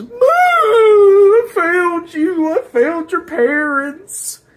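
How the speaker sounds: high, drawn-out mock bawling; mock crying. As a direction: wail it out like an over-the-top crybaby. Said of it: "starts breaking down in tears".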